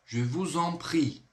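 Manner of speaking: slow "Je vous en prie", S linked as Z into "en"; liaison demonstrated. Shown as a demonstration. French teacher showing pupils the liaison, slow linked pronunciation. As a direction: carefully join "vous en" with a Z sound. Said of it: "Eu vou ligar o S com o E N".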